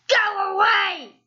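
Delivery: slow, loud rude shout. Acted. Mister Uppity rudely shouting at the goblin. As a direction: bark him away with contempt. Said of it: "said Mister Uppity rudely".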